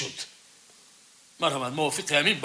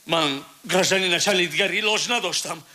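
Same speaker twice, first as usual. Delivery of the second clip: ordinary talk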